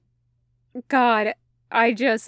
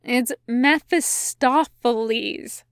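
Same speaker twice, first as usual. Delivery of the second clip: "Mephistopheles" said slowly, syllable by syllable; deliberate, measured stress on "Mephistopheles"; pointed correction; slower than usual. A narrator's reading. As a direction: spell out "Mephistopheles" carefully to correct them. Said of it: "he corrected".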